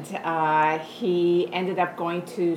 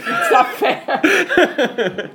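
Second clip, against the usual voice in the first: laughing; 11 semitones higher, 10 dB louder, much slower than usual. Real, not acted. Laughing protest at being teased.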